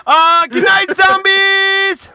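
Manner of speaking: loud high farewell yell; sign-off shout. Real, not acted. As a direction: call out to the audience.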